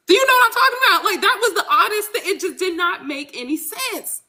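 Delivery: high-pitched exasperated rant; getting upset, with a little laugh; worked-up upset. Real, not acted. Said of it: "I'm getting upset all over again".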